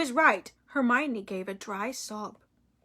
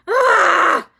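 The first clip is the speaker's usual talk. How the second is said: sharp yelp of pain; a sudden loud ah of pain; startled shout; pained cry; 7 semitones higher, 11 dB louder than usual. A narrator's reading. Narrator voices sudden pain.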